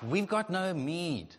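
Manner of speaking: slow, drawn-out whiny complaint; grumbling Israelites. Acted. Israelites to God about manna, slow mock whine. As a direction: moan it like a spoilt crowd.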